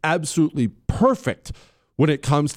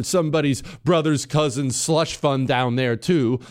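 usual speaking voice

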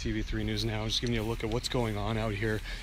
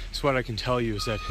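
ordinary delivery

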